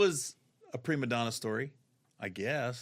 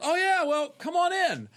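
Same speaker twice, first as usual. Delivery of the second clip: high, loud mock welcome; breezy GM greeting; 14 semitones higher, 5 dB louder than usual. GM welcoming the comic inside, high loud breezy greeting. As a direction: sing out a friendly welcome. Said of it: "she goes".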